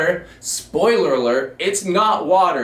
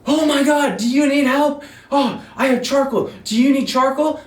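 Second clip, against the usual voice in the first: put-on panicked girls; 11 semitones higher than usual. Fans freaking out about gasoline, high frantic girly voice. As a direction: squeal with alarm like a fan.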